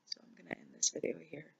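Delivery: real tears, whisper the goodbye; tearful whisper. Real, not acted. Ending her vlog to viewers in a tearful whisper.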